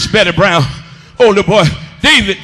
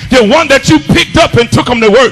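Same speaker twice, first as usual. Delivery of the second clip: building, rhythmic sermon list; full-force preaching; 4 semitones higher, 4 dB louder than usual. Real, not acted. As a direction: pile up each phrase with rising force.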